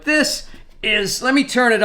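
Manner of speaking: straining while lifting something heavy; grunting with effort. Real, not acted. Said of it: "I'm grunting like it's heavy".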